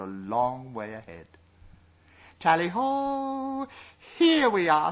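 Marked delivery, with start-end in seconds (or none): 2.4-3.7 drawn-out high hunting call; excited shout. A narrator's reading. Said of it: "shouted Simon". 4.2-4.9 loud excited call; shouting. A narrator's reading. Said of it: "shouted Simon".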